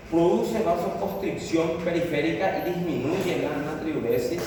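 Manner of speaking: ordinary talk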